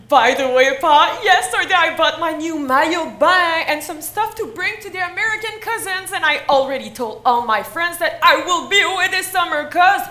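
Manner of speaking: loud, gushing excitement building to a shout; excited announcement. Acted. Teen telling her father about the summer trip, bursting with excitement. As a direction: gush it all out, then cry the last words in singsong.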